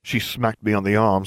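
plain reading voice

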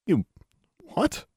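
halting, high, hushed question; stunned disbelief. A narrator's reading. Daughter stunned by mother's reaction, halting disbelief. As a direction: falter in disbelief.

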